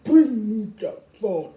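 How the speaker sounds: loud, firm mom order; imitating mom, loud and firm; stern mom. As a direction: demand it like a strict mother. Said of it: "my mom was like".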